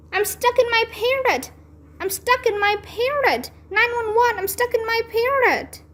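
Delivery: high panicked yelling; panicked shout. A narrator's reading. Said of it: "I panicked and shouted".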